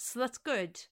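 ordinary talk, nothing marked

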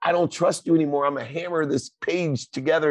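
hammed-up high Grover yelling at the reader; frantic Grover. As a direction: squeal it like a panicked Grover. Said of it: "he says".

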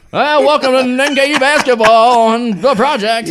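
high, slow put-on radio announcer; random announcer voice. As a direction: ham up a goofy radio host intro. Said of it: "I don't know what that voice was I just did".

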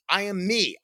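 usual speaking voice

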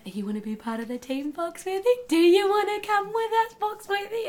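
high sing-song coaxing a horse; sweet high baby talk to the horse; coaxing animal. Acted. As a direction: coo to the animal like a pet. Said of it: "I say in Foxworthy's ear".

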